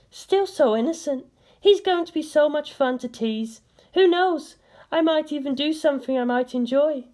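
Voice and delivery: playful high female voice; Misato. A narrator's reading. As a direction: play a teasing young woman. Misato's teasing thoughts.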